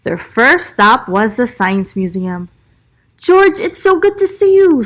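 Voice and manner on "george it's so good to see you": high, bright-voiced greeting, louder and higher; Doctor Lee. A narrator's reading. Museum director happily greeting George, high and loud. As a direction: greet George with delight, pitched well up. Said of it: "said Doctor Lee".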